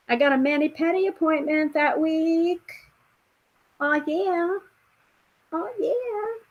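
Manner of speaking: high sing-song imitation of mom's texts; acted-out excitement. Acts out mom's excited text messages.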